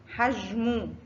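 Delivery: ordinary delivery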